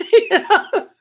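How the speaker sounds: real laugh through the words. Real, not acted. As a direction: laugh it out, pitched well up. Laughs at the old joke.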